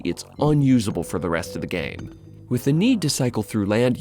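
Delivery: normal talking voice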